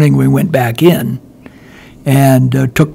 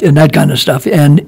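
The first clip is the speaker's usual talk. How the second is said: normal talking voice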